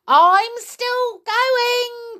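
very high, loud, slow screech; screechy old voice. A narrator's reading. Grandma calls from up above, screechy old voice. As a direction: screech it like an exultant old woman. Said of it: "came the old screechy d-, voice".

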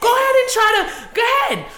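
loud, high, put-on New York dare; New York accent. Woman daring New Yorkers to catcall her, put-on New York accent. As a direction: taunt them in a shouted New York accent. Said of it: "I'ma use my New York accent".

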